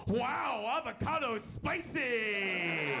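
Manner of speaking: high, drawn-out mock holler; mock yell. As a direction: imitate a startled diner crying out slowly. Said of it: "he yelled out the greatest thing".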